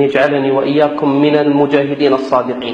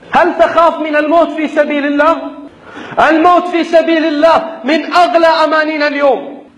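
raised, insistent sermon voice; fiery preaching; 9 semitones higher than usual. Acted. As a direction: preach it with rising fervor. Rousing sermon to fighters.

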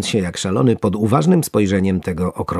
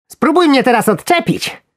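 high, loud squeaky taunt; smug satisfaction; 5 dB louder than usual. A narrator's reading. Worm daring angler to unhook him, smug squeak. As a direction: gloat as a pleased little worm. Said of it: "mruknął robak z satysfakcją".

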